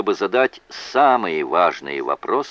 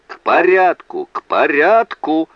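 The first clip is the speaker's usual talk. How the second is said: calling to order; 9 semitones higher, 7 dB louder than usual. A narrator's reading. Judge banging gavel at noisy court, loud call for order. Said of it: "воззвал Нус, стуча молотком".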